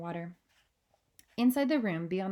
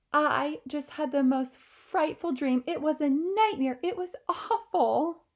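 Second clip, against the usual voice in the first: higher, slow shaken royal voice; the queen shaken by a nightmare; the queen, frightened; 8 semitones higher, 5 dB louder than usual. Acted. Queen telling Mary her dream, higher and slow.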